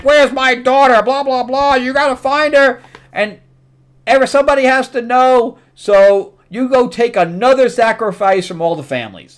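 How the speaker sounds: high, loud voice of a commanding sun king; king's voice. Retold or imitated. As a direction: boom out his orders like a royal father. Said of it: "And he's like".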